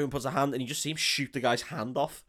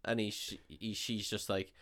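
usual speaking voice

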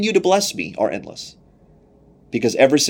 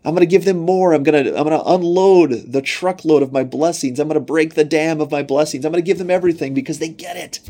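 higher, eager promise of blessings; God delighting in faithful Ephesians, higher and eager; delighted God; 5 semitones higher, 3 dB louder than usual. Acted. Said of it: "And God says".

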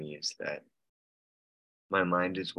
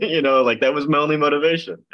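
high, loud, through laughter; amused, laughing; 7 semitones higher, 10 dB louder than usual. Real, not acted. Member confessing his old motive to group, laughing. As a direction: laugh as you admit it.

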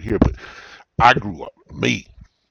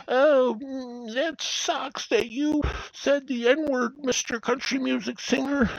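very high, slow whiny mockery; whiny baby boomer; slower than usual. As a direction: whine like an offended boomer. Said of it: "really fucking whiny and like".

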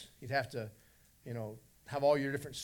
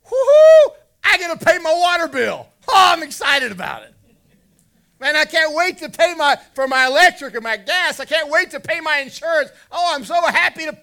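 hammed-up squeal of joy, much higher and louder; mock excitement; 14 semitones higher, 20 dB louder than usual. Preacher mocking a churchgoer thrilled to pay bills, hammed-up glee. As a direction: gush like a thrilled bill payer. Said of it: "if you go to the bank and pay a bill and go".

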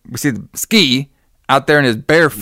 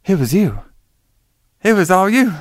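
dramatic accusation; 6 semitones higher than usual. Retold or imitated. Stabbed heroine accusing her killer, mock-dramatic. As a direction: accuse your betrayer, hammed up.

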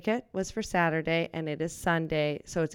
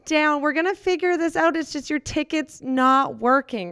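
shouting calm down; 8 semitones higher, 4 dB louder than usual. Acted. Flustered worker shouts at the angry customer to calm down. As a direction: yell for calm while losing it.

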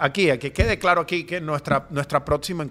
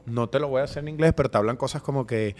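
ordinary talk

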